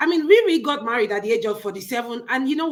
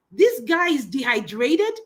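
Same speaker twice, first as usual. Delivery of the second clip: normal talking voice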